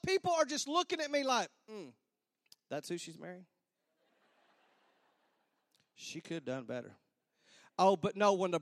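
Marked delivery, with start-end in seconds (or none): 1.7-6.9 low, soft muttered judgement; guests' disapproving murmur. Retold or imitated. Wedding guests looking over the groom, low disapproving murmur. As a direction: mutter it low, as gossip in the pews.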